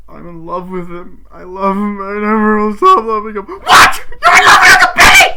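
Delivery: hammed-up confession erupting into shrieked disbelief; acted outburst. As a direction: play the family bombshell at full volume. Acted confession and shocked reply.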